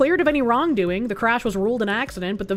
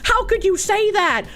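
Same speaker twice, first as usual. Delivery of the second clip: mocking cuck husband; 6 semitones higher than usual. Streamer mocking the TV husband, higher pleading. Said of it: "he's like".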